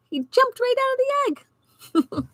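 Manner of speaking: storyteller delighted, very high; excited. Real, not acted. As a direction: squeal it with joy. Excited aside.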